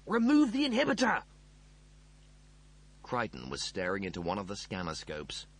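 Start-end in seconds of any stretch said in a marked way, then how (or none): 0.0-1.2 high, loud urgent command; yelled order. A narrator's reading. Rimmer ordering the mechanoid, yelling. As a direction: yell the instruction across the room. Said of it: "Rimmer yelled".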